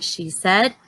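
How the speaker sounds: ordinary delivery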